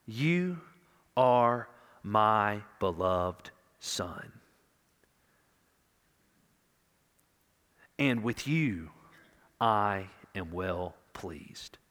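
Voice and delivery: slow, hushed and tender; tender fatherly voice. Retold or imitated. The Father speaking privately to his Son, slow and tender. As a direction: let each word land like a father's comfort, slowly. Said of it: "to have the Father say".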